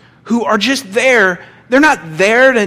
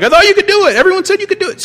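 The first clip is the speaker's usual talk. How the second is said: high, loud pleading complaint; worked-up desperate dad; 14 semitones higher, 5 dB louder, much faster than usual. Acted. Desperate dad confronting the disciples, worked-up high protest. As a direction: act out a distraught dad, voice shooting way up. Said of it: "He's all kinda worked up".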